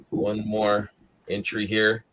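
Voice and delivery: ordinary talk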